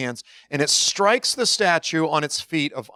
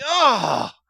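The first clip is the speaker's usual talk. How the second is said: high-pitched mock groan; dismayed groan; 8 semitones higher than usual. Retelling his failed offer of prayer, dismayed groan. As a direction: groan as if you just put your foot in it.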